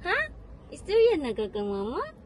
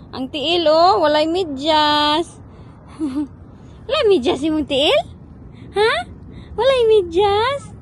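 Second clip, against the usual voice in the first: sing-song question to the infant; baby talk; 3 semitones higher, 8 dB louder than usual. Acted. Mother cooing to baby.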